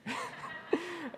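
put-on a high little baby giggle, lightly done; soft, airy baby laugh; imitated baby giggle. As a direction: mimic a baby's delighted giggle. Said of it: "and she's just like".

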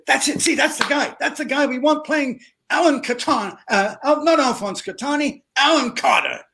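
high, excited exclaiming, acted out; excited outburst. Director telling producers he's found their guy, excitedly. Acts out director seizing on the idea.

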